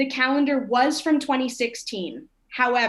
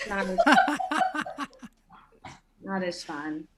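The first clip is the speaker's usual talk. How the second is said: ordinary talk, nothing marked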